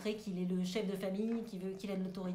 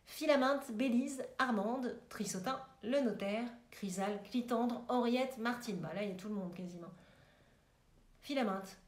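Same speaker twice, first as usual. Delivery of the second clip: normal talking voice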